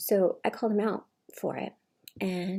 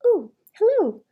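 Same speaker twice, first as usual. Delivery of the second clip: much higher, loud sugary "Ooh, hello"; sudden fake politeness; 14 semitones higher, 12 dB louder than usual. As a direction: switch instantly from rage to sweet greeting.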